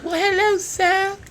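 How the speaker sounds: put-on high, loud little boy hello; squeaky kid greeting. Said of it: "Jean-Paul said".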